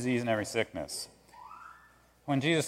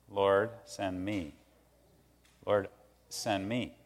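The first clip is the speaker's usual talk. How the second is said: sincere prayer; 5 semitones lower, 7 dB quieter, slower than usual. Acted.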